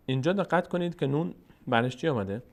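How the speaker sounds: usual speaking voice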